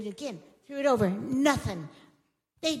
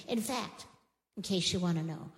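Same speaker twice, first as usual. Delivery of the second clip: normal talking voice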